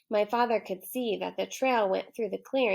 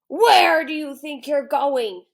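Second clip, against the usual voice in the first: loud, slow lion demand, pitched up; gruff lion voice; 5 semitones higher, 10 dB louder than usual. Acted. Lion challenging the boy, loud slow demand. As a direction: menace the boy.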